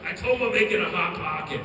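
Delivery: loud cartoonish excuse; brother's Rick voice. Brother gives cop silly alibi, loud Rick-like voice. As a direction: imitate a brother's absurd alibi at full volume. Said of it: "his story sounded like that".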